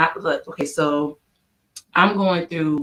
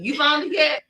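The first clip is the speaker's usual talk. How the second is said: teasing challenge; 8 semitones higher than usual. Real, not acted. Teasing question to daughter.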